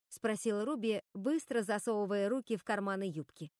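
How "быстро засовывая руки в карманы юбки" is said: usual speaking voice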